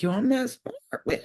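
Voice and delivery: slow, high, smug self-praise; hammed-up smug drawl; smug brag. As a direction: gloat slowly like you're a genius.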